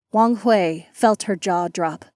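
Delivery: plain reading voice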